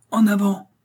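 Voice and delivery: high, loud rallying shout; battle cry. A narrator's reading. Athos rallying his three companions to fight, battle cry. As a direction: cry the charge to your comrades. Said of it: "cria Athos".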